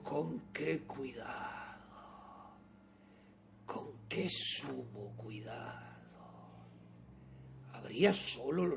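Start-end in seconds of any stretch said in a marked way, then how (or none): 0.0-6.0 slow, soft, low near-whisper; drawn-out secretive murmur; hushed caution. Acted. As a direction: savour each word with extreme care. Madman savours his extreme caution.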